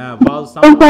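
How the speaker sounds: excited confirmation. Real, not acted. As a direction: praise the right answer eagerly. Confirms student's answer. (উচ্ছ্বসিত জোরে সমর্থন)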